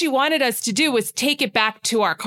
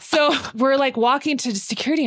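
ordinary delivery

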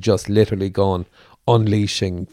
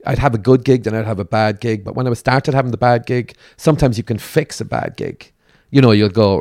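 ordinary delivery

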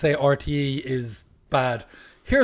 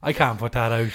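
ordinary talk, nothing marked

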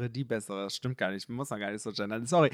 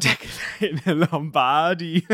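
mocking repeat; 3 semitones higher, 7 dB louder, much slower than usual. Real, not acted.

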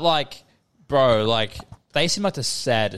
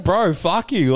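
higher-pitched, exasperated retort; indignant comeback; 5 semitones higher, 3 dB louder, much slower than usual. Retold or imitated. Telling friend how he cursed the salesman, indignant. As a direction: retell it with mock outrage.

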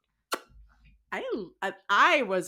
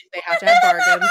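squealed emphasis; 10 dB louder, faster than usual. Shown as a demonstration. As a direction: have to have bargains squeaked very high and louder.

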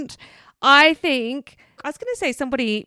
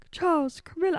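King Charles impression; 6 semitones higher, 4 dB quieter, faster than usual. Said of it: "I sound like Grover".

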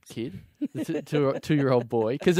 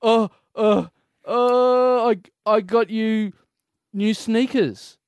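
put-on higher, slow, teary little-kid voice; whimpering toddler; 7 semitones higher, 4 dB louder, much slower than usual. [name] voices the nephew who was threatened, higher, slow and teary. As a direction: play a scared two-year-old stammering out an answer. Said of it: "he goes".

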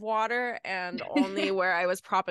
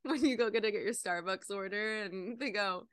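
ordinary talk

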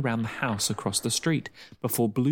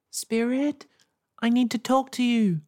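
high, slightly louder call; a little louder; 13 semitones higher, 3 dB louder than usual. A narrator's reading. Girl calling to the spirit a little louder. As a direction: call again a bit louder. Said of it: "just a little louder".

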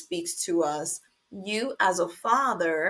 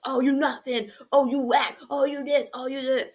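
mock trash talk; 5 semitones higher, faster than usual. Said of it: "It's like".

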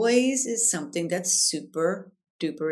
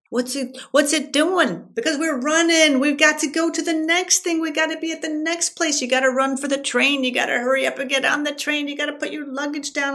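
high, fast, breathless-sounding tirade; frantic rushing; 9 semitones higher, 4 dB louder than usual. Retold or imitated. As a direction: rattle it off in a mock panic, pitched up. Acting out frantic rush for train.

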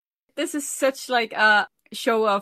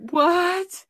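high, louder mock-shocked exclamation; retold shock; 11 semitones higher, 5 dB louder than usual. Podcast host recalling the first episode, retold shock. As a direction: act out jaw-dropped disbelief, pitched up high. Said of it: "my jaw was".

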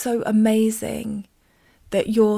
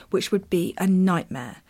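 ordinary talk

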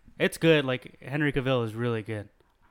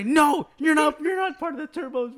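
shouting; 12 semitones higher, 4 dB louder than usual. Retold or imitated. Kid voices refusing, shouted.

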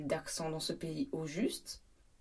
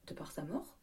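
mock whisper; 2 semitones lower, 7 dB quieter than usual. Joke about [name]' death.

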